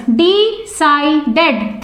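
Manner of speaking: Decided broken as De-ci-ded; split into syllables. Shown as a demonstration. Teacher showing students three syllables, Decided split as De-ci-ded. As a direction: say Decided in three separate beats. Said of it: "three syllables".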